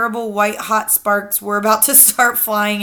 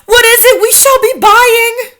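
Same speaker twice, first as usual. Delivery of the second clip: high, loud fawning witch voices; high loud witch chorus; eager witches; 11 dB louder than usual. A narrator's reading. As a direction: beg the leader like adoring witches. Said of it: "they cried".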